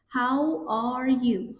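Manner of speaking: "How are you" stretched very slowly, stress on "How"; slow demo. Shown as a demonstration. Teacher modelling a greeting slowly for students. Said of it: "How یعنی چطوره".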